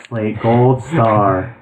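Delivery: slow doting praise. Acted. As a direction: stretch it like petting a pet. Praising dog character.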